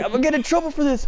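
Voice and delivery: high, rushed imitation of a worried kid; mock panic. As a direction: gabble it in exaggerated high-pitched dread. Imitates friend panicking while kicking again.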